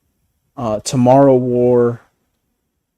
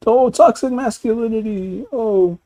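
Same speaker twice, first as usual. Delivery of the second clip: high whiny mock voice, much higher; mocking whiny critic; 11 semitones higher than usual. Mocking critics who cancel shows, a high whiny voice. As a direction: sneer it like a whining critic.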